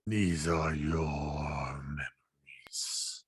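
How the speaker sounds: slow, low, eerie whisper; whispering stone creature. Acted. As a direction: breathe it like a grave speaking. GM voices the stone figure.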